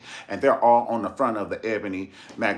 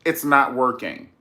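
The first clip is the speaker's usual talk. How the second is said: firm retort; 5 semitones higher, 8 dB louder, slower than usual. Retold or imitated. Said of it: "She said".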